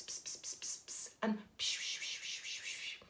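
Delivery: soft unvoiced pss-pss coaxing; whispery psh-psh cat call; encouraging cat noises. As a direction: hiss gently to coax a cat along. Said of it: "lovely little encouraging noises".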